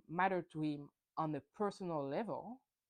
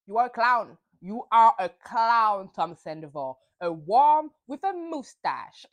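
much louder, slow, punched-out insult; imitating a loud insult; 11 dB louder than usual. Retold Ariana blasting Tom Sandoval, loud and slow. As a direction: spit each word slowly at him. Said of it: "'cause she told him".

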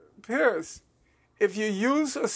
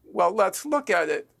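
usual speaking voice

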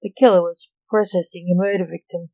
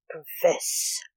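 ordering; 7 dB quieter than usual. A narrator's reading. Lance with his sword in the killer's back, ordering. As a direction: order it softly, almost without voice. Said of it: "Lance ordered".

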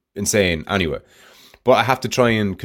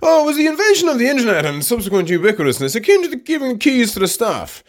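mocking self-parody; 4 dB louder than usual. Husband imagining wife overhearing his stoned musings, mocking high self-parody. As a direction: send up your own rambling in a silly high voice. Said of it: "to hear me going something along the lines of".

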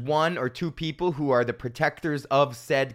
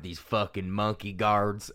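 low, slow, softer Southern drawl; mock Southern drawl, pitched down; Southern accent; 6 semitones lower, 5 dB quieter, slower than usual. Shown as a demonstration. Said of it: "sounds worse when you put a southern accent on it".

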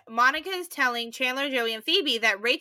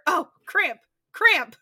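mock pained cry; 6 semitones higher, 4 dB louder, slower than usual. Acting out Rachel's cramp.

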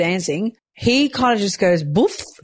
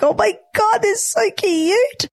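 much higher, slow squeal of delight over tiny feet; gushing squeal. Retold or imitated. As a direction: melt over the babies' cute little feet. Gushing over babies' little feet.

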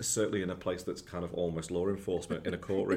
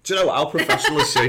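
laughing; 7 semitones higher, 12 dB louder, faster than usual. Real, not acted. Teasing co-host, laughing.

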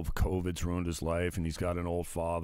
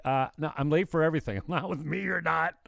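mock friend's voice; 10 semitones higher than usual. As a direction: rattle it off like the friend who's always late. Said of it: "And she's like".

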